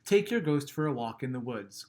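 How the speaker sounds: plain reading voice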